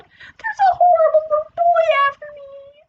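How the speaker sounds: high, loud panicked alarm; terrified yelling. A narrator's reading. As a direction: yell it as if truly chased. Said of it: "he yelled".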